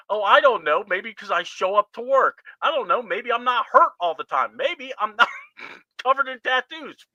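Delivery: high, sarcastic mock reply; imitating a smug wrestler; sarcastic Cody. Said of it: "Why would he ask you".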